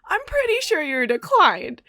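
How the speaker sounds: mock disbelief at a stubborn customer; exasperated. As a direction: spell it out like the last straw. Exasperated cashier repeating the decline.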